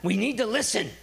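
high, exasperated mock shout; acted-out boy demanding silence; imitated brother's yell. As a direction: yell at your sister to hush. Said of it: "all you could really hear was David saying".

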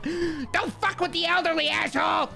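put-on high, cackling old-man yell. Elderly man yelling at the driver who left. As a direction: screech it after them.

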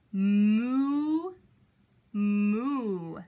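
long, drawn-out moos; imitated cow call; cow mooing. Shown as a demonstration. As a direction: moo loudly like a cow, holding the vowel. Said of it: "What does a cow say?".

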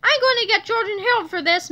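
high, vengeful threat; mild angry yell. A narrator's reading. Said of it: "Mister Krupp screamed".

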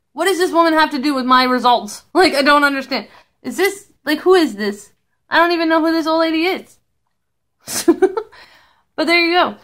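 ordinary talk

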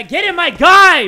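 high, very loud egging-on yell; urging shout. Real, not acted. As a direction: holler it to spur your guy on. Urging the climber on, louder.

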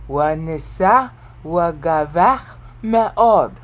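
Wanisa wagavach me'od read slowly in Hebrew; Hebrew recitation. Shown as a demonstration. Teacher reading the second Hebrew clause slowly. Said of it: "Eight syllables".

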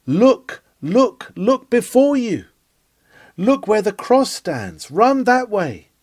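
preacher urging sinners toward the cross, rising cry; urgent pulpit cry. Acted. Urgent repeated pulpit command.

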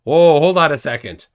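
loud, playful objection; mock outrage. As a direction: burst in loudly, as if offended. Mock outrage at tea insult.

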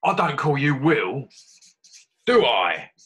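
gruff. Acted. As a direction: snap it back gruffly. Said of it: "he said gruffly".

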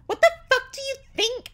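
hammed-up, very high, loud retort; angry. A narrator's reading. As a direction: screech it back in mock fury. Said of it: "I asked angrily".

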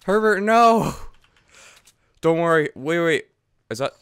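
normal talking voice

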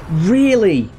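high, slow, stretched-out disbelief; can't believe how weak that hit was, drag it out high; incredulous disbelief. Real, not acted.

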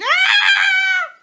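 high, loud shriek of fright; scream. A narrator's reading. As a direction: shriek in terror. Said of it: "She screamed".

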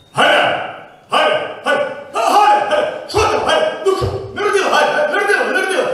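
husband yelling for his wife at the maternity ward, high excited calls; calling out. Acted. Husband shouting under maternity window.

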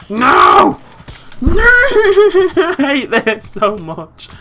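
very high, loud, drawn-out wail; laughing howl of frustration; frustrated scream. Real, not acted. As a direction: scream in exasperation, breaking into a laugh. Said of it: "I'm getting so frustrated".

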